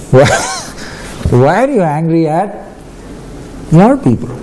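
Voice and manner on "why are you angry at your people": usual speaking voice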